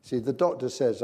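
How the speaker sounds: ordinary talk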